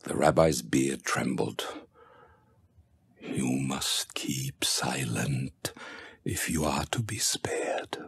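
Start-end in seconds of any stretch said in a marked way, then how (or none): 3.2-8.0 slow, much softer whisper; dying whisper. A narrator's reading. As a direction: breathe the warning with the last strength. Dying rabbi warns his daughter.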